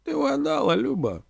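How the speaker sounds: very high, theatrical reply; lofty orator conceding a point; declaiming orator Omela. A narrator's reading. Omela answering Donka's remark, declaiming.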